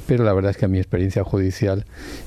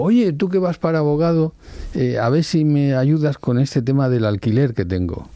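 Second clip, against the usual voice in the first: higher, lightly voiced uncle asking for help; a hint of the uncle, pitched up, casual and friendly; uncle asking a favour; 6 semitones higher than usual. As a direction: nephew retells his uncle's request, lightly acted. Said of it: "Tenía un tío que me dijo".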